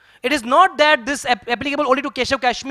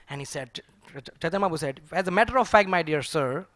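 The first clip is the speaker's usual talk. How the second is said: usual speaking voice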